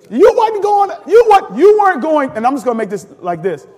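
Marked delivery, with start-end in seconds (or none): 0.1-2.3 loud, high-pitched preacher's insistence; full-force preaching. Real, not acted. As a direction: drive it home to the congregation, loudly.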